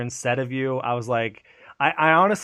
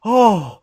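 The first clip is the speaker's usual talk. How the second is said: high, loud "oh" of relief; huge relieved exhale; 9 semitones higher, 8 dB louder than usual. Retold or imitated. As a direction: let all the tension out in one re-enacted sigh. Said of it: "I let out this huge exhale".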